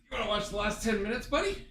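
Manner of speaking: pet talk. Real, not acted. Cooing to the dog mid-game.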